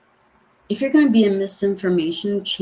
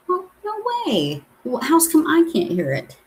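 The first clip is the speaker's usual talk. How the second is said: surprised; 9 semitones higher than usual. Real, not acted. Learns viewers heard the video.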